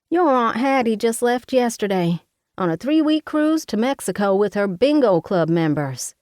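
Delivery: high, loud fussing mother voice; a bossy Southern mama fussing over her daughter. Acted.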